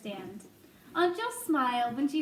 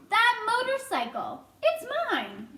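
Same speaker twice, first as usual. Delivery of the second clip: high-pitched insistence; insistent claim; 9 semitones higher, 3 dB louder than usual. A narrator's reading. Mouse claims the motorcycle from the boy, insistent. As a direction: push it high and emphatic.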